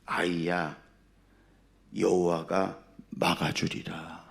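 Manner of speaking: low, soft and slow divine voice; voice of God. Acted. God speaks to praying wife, low slow voice. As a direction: reassure with hushed, unhurried authority. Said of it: "하나님이 그러시더래요".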